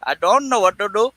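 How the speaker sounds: high put-on silly voice; mock-panicked squeaky voice; playfully whining in a high cartoonish voice; funny voice. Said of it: "I don't know why I'm talking like this".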